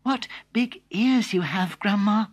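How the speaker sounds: put-on a girl noticing strange ears; wondering girl.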